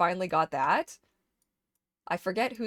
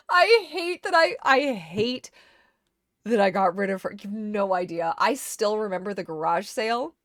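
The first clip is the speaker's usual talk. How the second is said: upset lament; 9 dB louder than usual. Real, not acted. Upset about giving away pony.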